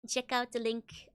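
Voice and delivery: ordinary talk, nothing marked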